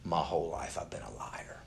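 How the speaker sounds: soft whisper of a man breaking; a hushed, lightly voiced admission of shame; retold tearful confession. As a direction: let the confession slip out quietly. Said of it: "He starts crying".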